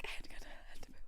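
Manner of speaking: soft, breath-only urgency; desperate whisper. Retold or imitated. Kid to parents who caught up, desperate whisper. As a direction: squeeze it out in a strained whisper.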